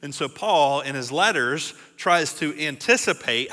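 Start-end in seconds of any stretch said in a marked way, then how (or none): none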